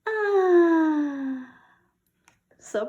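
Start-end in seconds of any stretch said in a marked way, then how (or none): 0.1-1.6 high, loud adoring "Ah"; a big melting "Ah" for the kitten. Shown as a demonstration. Teacher modelling the kitten sound, high and loud.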